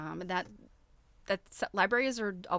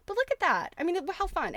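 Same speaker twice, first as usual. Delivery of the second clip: delighted excitement; 10 semitones higher, 5 dB louder than usual. Real, not acted. Delighted at the book page.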